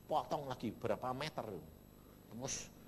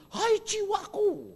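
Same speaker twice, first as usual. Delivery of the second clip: slow, high, drawn-out call to the soul; King David addressing his soul; 8 dB louder, slower than usual. Retold or imitated. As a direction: call out slowly to your own soul. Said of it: "Daud ngomong gitu". (Daud memanggil jiwanya, pelan dan tinggi)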